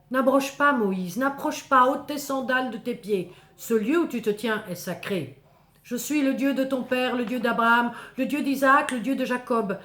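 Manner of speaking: loud, booming divine voice; booming voice of God. Acted. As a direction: speak as God from the burning bush. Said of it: "le buisson me dit". (voix de Dieu tonnante et solennelle)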